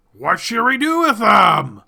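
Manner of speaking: masked leader shouting to his gathered creatures; frenzied shout. Acted. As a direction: whip the crowd up with it. Frenzied masked leader riles crowd.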